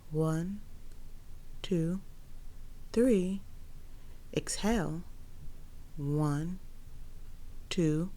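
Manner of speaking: slow, soft breath counting. Acted. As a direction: count the breaths slowly and softly.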